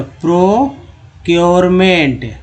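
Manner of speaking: "Procurement" very slow and emphatic, part by part; broken into parts. Shown as a demonstration. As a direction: stretch each part of "procurement" for the class. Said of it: "cure हो गया". ("Procurement" को खींचकर, हिस्सों में)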